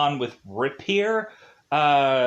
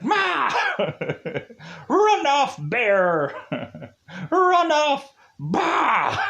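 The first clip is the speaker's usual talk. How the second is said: yelling at a bear; 10 semitones higher, much slower than usual. Retold or imitated. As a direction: shoo the bear off, hammed up and laughing. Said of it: "he scares the bear by yelling at it".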